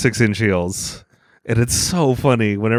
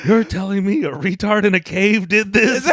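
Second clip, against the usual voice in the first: higher-pitched parody of a movie villain; mock incredulous outrage; 7 semitones higher than usual. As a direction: play outraged disbelief for laughs.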